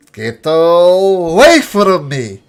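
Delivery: high, loud, slow bellow; roaring shout. Acted. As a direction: blast them away with a roar. Same blast repeated.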